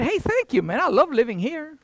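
high mock grateful dog; dog thanking its owner; imagined dog voice. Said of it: "But they never said".

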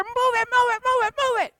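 loud, high drill-sergeant bark; Sergeant Carter. Retold or imitated. Sergeant yelling at Gomer Pyle to hurry, loud and high. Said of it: "He yelled at Gomer Pyle in his ear".

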